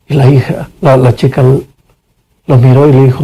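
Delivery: usual speaking voice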